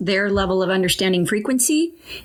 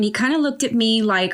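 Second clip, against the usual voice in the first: ordinary talk, nothing marked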